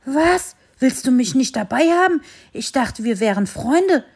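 dog feels rejected by his friend, indignant. Acted. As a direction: take offence, hurt and outraged. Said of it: "entrüstete sich Bello".

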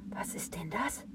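whispered to itself. A narrator's reading. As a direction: breathe the question in a hushed whisper. Tree wonders to itself.

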